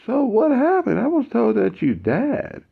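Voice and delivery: high, then slowing on the last words; urgent disbelief. Retold or imitated. Matt pressing Mike about dying, high and slowing. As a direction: press him, high and earnest.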